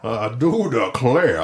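slow, drawn-out Georgian drawl; hammed-up slow Georgian imitation; Georgian accent. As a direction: draw it out like a Georgian gentleman detective. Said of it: "that weird Georgian accent".

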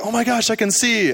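high-pitched, excited exclamation; freaking out. Retold or imitated. Woman retold as suddenly seeing after prayer, freaking out. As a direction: imitate someone freaking out with amazement. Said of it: "she started freaking out".

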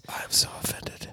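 whispered hurt feelings; mock whisper. Cohost pretending to be offended, whispering. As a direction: hiss it as if wounded.

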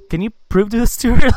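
high, loud pleading question; retold appeal. Acting out his appeal to Winkler.

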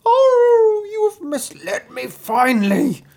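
high, loud wounded-wolf howl; long wailing cry sliding into words. A narrator's reading. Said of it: "went to the fox limping and howling".

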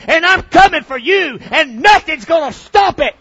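building to a furious shout; megalomaniac rant. Acted. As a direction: roar it as a wrathful god. Voicing God, rising threat.

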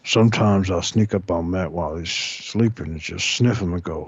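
put-on deep old man rambling; Wilford Brimley voice. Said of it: "Walter Brimley".

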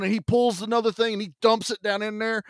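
usual speaking voice

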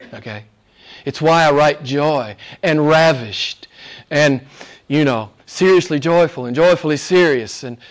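ordinary talk, nothing marked

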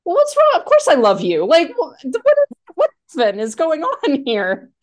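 high, loud, bewildered "what's wrong", half-acted; baffled protest. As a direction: play Walter as if the question makes no sense. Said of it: "he's just like".

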